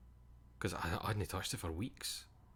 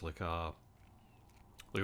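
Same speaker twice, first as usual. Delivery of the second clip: ordinary talk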